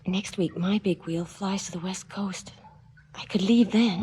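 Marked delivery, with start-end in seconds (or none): 3.1-4.0 whisper. Acted. Secret plan to escape.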